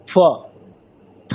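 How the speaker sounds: ফ with the lips pressed lightly; half pha. Shown as a demonstration. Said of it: "ওষ্ঠ দুটো একেবারে অতটা press না করে". (ঠোঁট আলতো রেখে অর্ধেক ফ)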